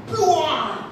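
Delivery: high explosive "buah"; imitated blast. Preacher showing people thrown back, high imitated blast. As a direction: burst out high, then let it fall.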